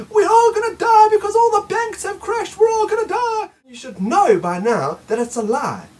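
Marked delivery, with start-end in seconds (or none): 0.1-3.5 high, loud panicky shout; hammed-up doom shout; mock panic. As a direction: wail it like the sky is falling. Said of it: "then it was the".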